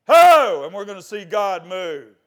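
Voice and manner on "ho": high, explosive full-voiced shout; battle shout. Shown as a demonstration. Preacher shouting as if before Jericho's walls, battle shout. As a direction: let out one huge rallying whoop. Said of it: "we're gonna shout".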